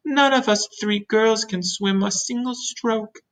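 high, slow Miss Spider voice; worried spider. Acted. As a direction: confess helplessness, high and drawn out. Miss Spider admits they cannot swim.

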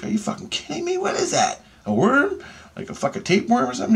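very high-pitched disbelieving questions; retold incredulity. Patient asking surgeon about the wriggling thing, incredulous.

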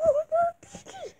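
put-on very high, crying kid voice; whining child. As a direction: whine like a spoilt toddler.

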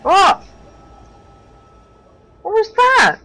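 loud, high-pitched startled yelp; shocked, high, loud outburst; startled shout. Real, not acted. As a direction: cry out in alarm, voice jumping up. Startled by loud event in game.

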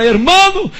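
loud, high call down the corridor; shout. Retold or imitated. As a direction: yell it down the prison hallway. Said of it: "ele gritou no corredor da cela".

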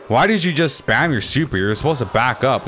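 high, heated demand; exasperated scolding. Real, not acted. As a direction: call out a teammate's blunder. Scolding teammate for misplay.

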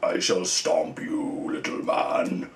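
slow, low giant's voice; mock-menacing monster growl; monster threat. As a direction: loom over a tiny foe, drawing out each word deep and slow. Voices monster figure towering over smaller one.

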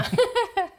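short high laugh; bright laugh. Real, not acted. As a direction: burst out laughing. Guest laughs at echo.

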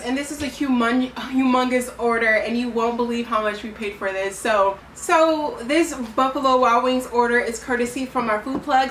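usual speaking voice

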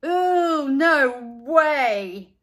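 mock horrified no; refusing boy. A narrator's reading. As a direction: push it away loudly. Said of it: "said Buster".

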